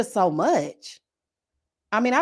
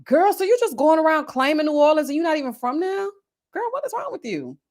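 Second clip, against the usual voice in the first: incredulous callout; 7 semitones higher than usual. Retold or imitated. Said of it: "I'm like".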